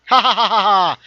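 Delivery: high, loud cackle in a put-on voice; mock villain cackle. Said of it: "Who's laughing here?".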